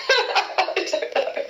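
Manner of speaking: giggling through the words; laughing. Real, not acted. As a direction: breaks into laughter mid-answer.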